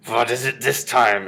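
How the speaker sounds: loud, slow, exasperated witch; drawn-out witch's shout; irritated shout. A narrator's reading. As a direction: call out crossly, stretching the words. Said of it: "shouted the Grand High Witch".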